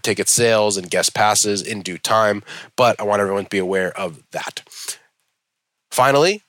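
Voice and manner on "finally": emphatic. Acted. Drawn-out announcement of last topic.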